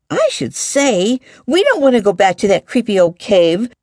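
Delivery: put-on high-pitched, firm girl; emphatic girlish refusal. A narrator's reading. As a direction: answer firmly, pitched high. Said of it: "Sarai responded firmly".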